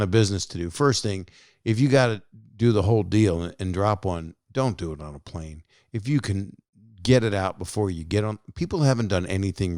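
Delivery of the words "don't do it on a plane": normal talking voice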